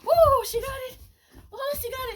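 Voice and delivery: high, loud cheering; excited cheer. Real, not acted. Trainer cheering her puppy catching the toy, excited cheer. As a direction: celebrate the catch.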